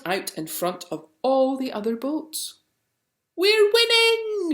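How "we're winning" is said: very high, loud, drawn-out cheer; joyful slow victory shout; triumphant shout. A narrator's reading. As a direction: yell it across the lake. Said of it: "shouted Basil".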